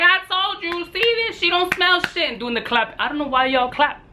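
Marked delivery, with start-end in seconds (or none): 0.0-2.3 high-pitched teasing imitation of the wife; wife gloating over him, high mock voice. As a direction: mimic her gloating, pitched way up. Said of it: "wifey's chiming in the background".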